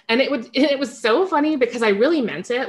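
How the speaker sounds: ordinary delivery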